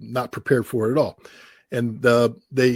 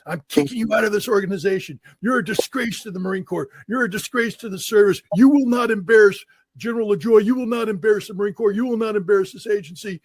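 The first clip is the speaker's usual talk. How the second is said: raised-pitch mock tirade from an angry general; retold dressing-down; 9 semitones higher than usual. General firing a Marine for bad Russian, in a raised mock tirade. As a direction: hammer each "You will not" like a furious commander.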